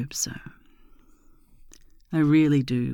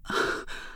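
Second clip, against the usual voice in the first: aroused sigh; 5 dB quieter than usual. Acted. Simulated arousal, climax build.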